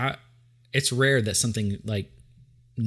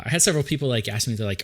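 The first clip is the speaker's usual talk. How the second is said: ordinary delivery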